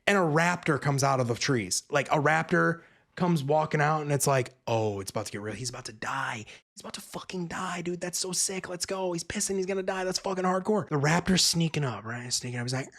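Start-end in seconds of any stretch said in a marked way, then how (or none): 4.7-10.9 soft, eager undertone; hushed excitement. Retold or imitated. Viewer watching the raptor creep up, hushed excitement. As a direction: keep it down while thrilled.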